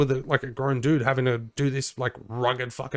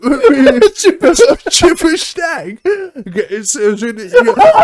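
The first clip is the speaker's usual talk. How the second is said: high, loud laughter breaking through the words; can barely get the words out for laughing; laughing fit; 11 semitones higher, 12 dB louder than usual. Real, not acted. Host breaks up laughing over his own chip and snag joke. Cracking up mid Aussie bit.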